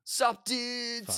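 breezy festival bro greeting; Coachella guy. Acted. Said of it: "it's Coachella guy".

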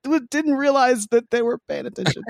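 high-pitched mock innocence; mock sheepish excuse. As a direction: retell a weak excuse in a high squeak. Sheepish excuse to glaring sister.

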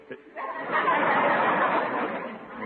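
high, loud embarrassed laugh that rises; a squirming, sheepish chuckle; nervous laugh. Acted. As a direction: laugh to cover being broke. Nervous laugh handing over money.

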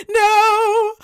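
high, loud whine; mock tearful wail. Mom imitates daughter refusing to dress, mock wailing. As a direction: stretch it into a hammed-up wail. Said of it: "she's just got, like, tears".

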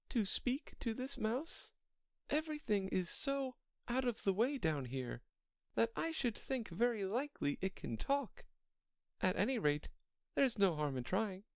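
high-pitched Alice voice, wondering; Alice pondering. Acted.

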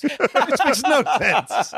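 words drawn out through real laughter; laughing. Real, not acted. Co-host reacts to the villain's absurd line, laughing.

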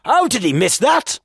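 high, exasperated fan's cry; shout over noise. Acted. Fan shouts to a stranger over the TV crowd, exasperated. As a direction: call it out in disbelief. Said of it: "shouted to me above the noise".

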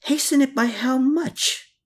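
high, startled-sounding question; crying out. A narrator's reading. Man balking at hastening his deal, crying out. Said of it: "he quietly cried out".

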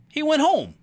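ordinary talk, nothing marked

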